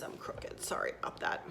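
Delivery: hushed, voiceless apology; whispered aside. Real, not acted. Vlogger apologizes to viewers for crooked shot, whispered aside. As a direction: whisper it quickly to yourself and viewers.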